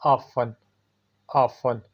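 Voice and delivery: ऑफन with a silent t, off-en; correct pronunciation. Shown as a demonstration. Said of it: "सही है".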